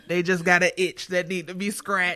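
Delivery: loud laugh, then laughing words. Real, not acted. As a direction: crack up at your own joke. Laughing at her own joke.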